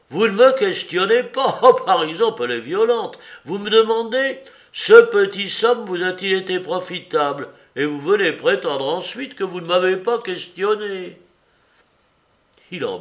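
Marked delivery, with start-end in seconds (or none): 0.1-11.2 aigu et fort, outré; indignation criée. Acted. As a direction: proteste avec véhémence. Soupe indigné s'emporte. (Soupe outré accuse Larrier de mentir, voix montée)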